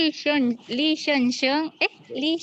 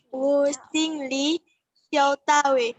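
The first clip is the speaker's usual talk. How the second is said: careful learner's Chinese accent. Shown as a demonstration. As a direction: read it like a native Chinese speaker. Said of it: "Mirip kali udah kamu sama orang Cina".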